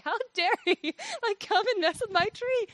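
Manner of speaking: high-pitched hammed-up indignation; mock outrage. Mad at the gardener for cutting her tree, high mock outrage. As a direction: play up a mock huff at the gardener. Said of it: "mad at the gardener".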